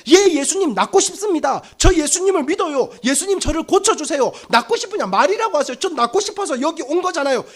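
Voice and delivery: high, emphatic begging answer; eager plea. Acted. Invalid should answer Jesus he wants healing, eager plea.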